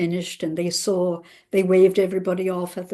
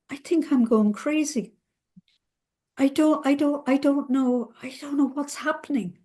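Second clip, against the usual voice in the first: distraught confusion; 6 semitones higher than usual. Retold or imitated.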